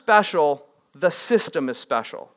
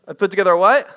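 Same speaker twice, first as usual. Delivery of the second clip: louder, pushing the room to answer; louder repeat prompt; 7 dB louder, faster than usual. Shown as a demonstration. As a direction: call it again, louder, demanding a reply.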